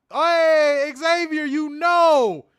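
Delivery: high, loud, slow drawn-out call; loud playful hail; mock call-out. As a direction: holler the name across the room, stretched out. Mock calling out a name.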